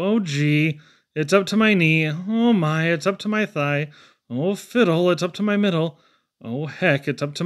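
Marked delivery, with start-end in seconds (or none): none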